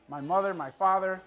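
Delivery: ordinary talk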